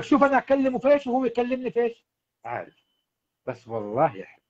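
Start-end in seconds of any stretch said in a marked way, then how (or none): 0.0-2.0 pitched-up mock wife voice; imitating the wife. Husband hears his wife complain to a friend, high mock voice. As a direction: mimic an exasperated wife, voice raised high. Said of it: "بتقول لك".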